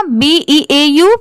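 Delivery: ordinary delivery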